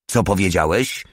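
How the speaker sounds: plain reading voice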